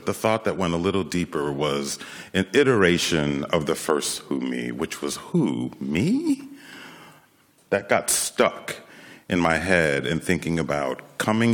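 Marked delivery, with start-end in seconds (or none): 5.2-6.4 "me?" leaping high in disbelief; questioning surprise. Acted. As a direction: ask it as if singled out unexpectedly. Acted self-questioning reaction.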